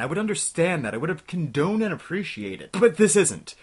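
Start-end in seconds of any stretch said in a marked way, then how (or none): 2.7-3.5 loud, high protest; exasperated outburst. Real, not acted. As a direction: snap it out, at the end of patience. Ranting at deplorable graphics.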